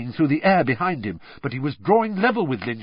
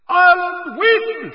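high, loud, drawn-out announcer shout; 6 dB louder, slower than usual. A narrator's reading. Bagman announcing the winner to the crowd, shouting. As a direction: proclaim the result to a whole stadium. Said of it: "shouted Bagman".

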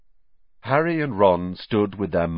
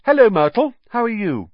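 high-pitched, over-friendly hello; high, careful friendliness; cheery greeting; 13 semitones higher, 6 dB louder than usual. A narrator's reading. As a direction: greet a touchy acquaintance brightly. Hermione brightly greets the ghost.

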